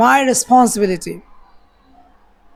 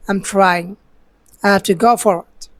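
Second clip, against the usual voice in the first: normal talking voice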